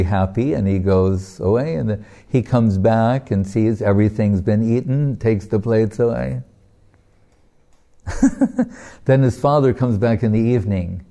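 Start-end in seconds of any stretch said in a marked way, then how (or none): none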